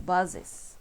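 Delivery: "buzzes" muy despacio, con la "-es" bien marcada; pronunciación clara. Shown as a demonstration. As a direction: estirar "buzzes" sílaba por sílaba. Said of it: "Buzz, que es". ("buzzes" lento, pronunciado como modelo)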